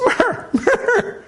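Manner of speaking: squeaky caricature of a German soldier; mock Nazi soldier. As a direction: play a flustered Nazi officer high and slow. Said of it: "And the Nazi says".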